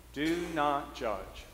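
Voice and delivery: very slow, each word weighed; slow emphatic command. Shown as a demonstration. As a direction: lay down the rule slowly. Repeating the teaching.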